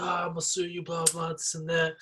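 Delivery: high mocking voice of a ranting woman; imitating angry woman. Woman cussing at brother, mocked high. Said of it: "She's like".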